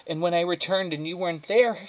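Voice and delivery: high-pitched, upset mother; distressed mother. Acted. Mother recalls finding Flora gone, distressed. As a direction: tell it on the edge of tears.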